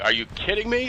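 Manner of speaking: high, shocked disbelief; incredulous. Retold or imitated. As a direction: pitch leaps up, can't believe it. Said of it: "You know, and it's like".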